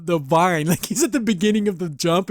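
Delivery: ordinary talk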